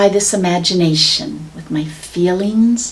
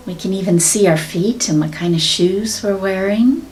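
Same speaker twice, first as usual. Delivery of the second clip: guided meditation. Acted. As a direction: guide them gently into visualization. Leads a meditation.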